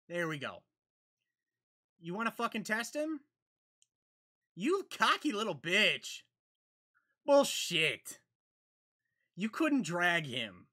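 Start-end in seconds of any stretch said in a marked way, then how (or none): none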